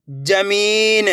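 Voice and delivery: जमीन slowly with मी held long. Shown as a demonstration. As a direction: draw out the ee in जमीन. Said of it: "मेरे साथ साथ इनको repeat करना है".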